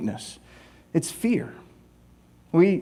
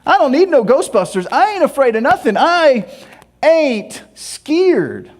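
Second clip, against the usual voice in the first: tough bravado; 7 semitones higher, slower than usual. Retold or imitated. Driver proving to the world he fears nothing, brash boast. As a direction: puff up and brag you fear nothing. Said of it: "trying to prove to the world".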